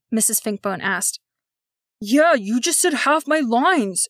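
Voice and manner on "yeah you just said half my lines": raised angry voice, slower; loudly complaining in front of everyone. Acted. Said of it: "loud enough that a few audience members must have heard".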